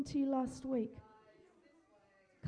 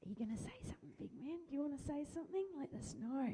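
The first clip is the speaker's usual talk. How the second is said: gentle coaxing; 9 dB quieter, much faster than usual. Real, not acted. Coaxing a shy child.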